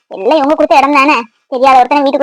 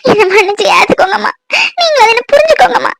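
high, sobbing cry for forgiveness; the daughter begs her mother for forgiveness, sobbing; crying plea; 5 semitones higher than usual. Acted. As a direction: beg mother through tears to take her back.

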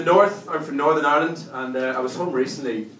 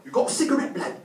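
street youth; 11 semitones higher, faster than usual. Acted. Youth asking him for a cigarette, high, less forceful voice. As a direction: repeat the request high but easing off. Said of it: "He's like".

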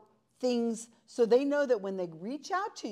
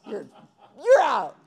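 mock ejection; 10 dB louder than usual. Joking ejection of a participant.

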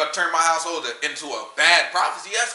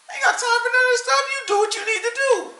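very high-pitched mock parent voice; squeaky, hands-off mock parent; exasperated parent. Voicing parents who give up.

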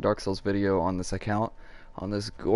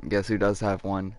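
ordinary talk

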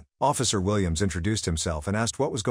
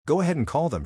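mild narrated yell; 7 semitones higher than usual. A narrator's reading. Neighbor dares owner to call police, understated yell. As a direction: read a yell with restraint. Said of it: "she yelled".

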